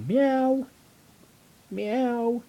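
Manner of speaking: put-on high sing-song meow for a toddler; playful kitty meows for the baby; cat meow. Shown as a demonstration. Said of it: "They go".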